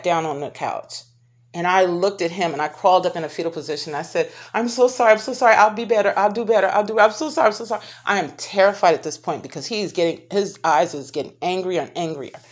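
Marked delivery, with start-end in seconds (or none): 4.5-7.8 higher, louder, desperate begging, words tumbling out; frantic pleading apology. Retold or imitated. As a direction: beg him to stop, curled up and terrified. Pleading while curled up in fear.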